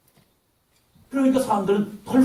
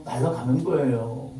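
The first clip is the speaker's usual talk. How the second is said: usual speaking voice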